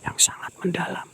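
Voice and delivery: frightened whisper. Real, not acted. Ghost hunter whispering that something is in front of him. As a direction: barely breathe it, it's right there.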